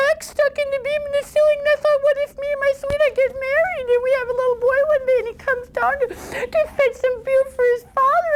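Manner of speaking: put-on very high, fast, breathless girl's wail; panicked foolish girl spilling out her fear; climbing to a squeak at the end; silly fretful daughter.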